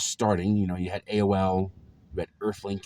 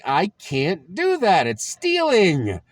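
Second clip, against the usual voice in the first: higher, louder and slow, dragging each word; loud slow refusal; 12 semitones higher, 10 dB louder, much slower than usual. Retold or imitated. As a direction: dig in and refuse, stretching it out. Teen refusing, argument with dad.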